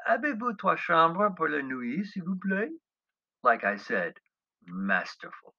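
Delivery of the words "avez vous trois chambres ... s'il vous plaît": put-on higher, overdone throaty French accent; hammed-up schoolboy French, proudly; glottal French. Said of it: "my most glottal recitation".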